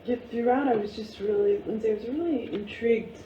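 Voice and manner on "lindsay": usual speaking voice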